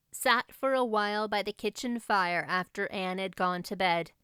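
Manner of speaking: usual speaking voice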